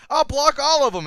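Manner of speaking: high, loud exasperated boast; hammed-up loud challenge; mock defiant shout. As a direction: yell it like a fighter losing patience. Retold opponent's loud boast.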